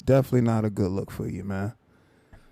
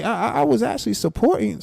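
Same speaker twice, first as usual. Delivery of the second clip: higher, mocking parrot of his words; mocking imitation; 8 semitones higher than usual. Streamer mocking the other guy's claim, in a higher voice. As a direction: mimic him to make him sound silly.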